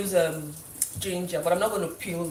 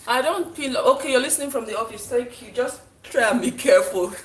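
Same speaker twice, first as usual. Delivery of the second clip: louder and higher, calling across; 6 semitones higher, 10 dB louder than usual. Real, not acted. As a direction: call to someone in another room. Calling to someone in office.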